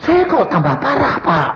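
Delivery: high, pleading complaint; complaining congregant. Acted. Congregant complains to pastor that prayer made things worse, high and distressed. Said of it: "kalau ada orang cerita".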